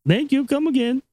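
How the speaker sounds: high, singsong mock Indian accent; put-on Indian-accented customer farewell; Indian shop clerk. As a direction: imitate a cheery Indian cashier. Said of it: "you work in a fucking".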